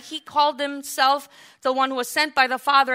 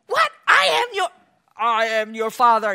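mock Joseph; 6 dB louder than usual. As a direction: play the wronged father for laughs. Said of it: "Joseph didn't go".

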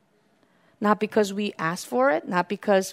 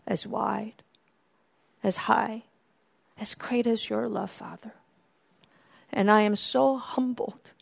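soft, near-whispered prayer of awe; hushed prayer; 7 dB quieter than usual. Pretend. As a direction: pray it softly, humbled.

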